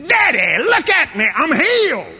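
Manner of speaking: high, loud cry of joy; excited child's shout. Retold or imitated. Little son calling to his father, loud excited shout. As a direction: call out to Daddy, thrilled.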